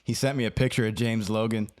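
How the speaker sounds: normal talking voice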